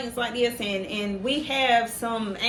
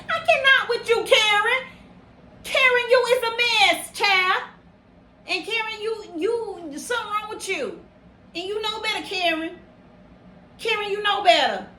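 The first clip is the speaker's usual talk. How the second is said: exasperated scolding; 7 semitones higher than usual. Real, not acted. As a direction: tell Karen off in disbelief. Scolding Karen directly.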